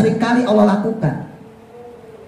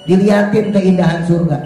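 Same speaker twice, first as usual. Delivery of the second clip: ordinary talk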